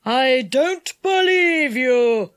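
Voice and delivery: slow, forceful villain's cry; enchanter's cry. A narrator's reading. Enchanter rejects Dick's excuse, crying out slowly. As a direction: cry out in disbelief, drawing it out. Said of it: "cried the enchanter".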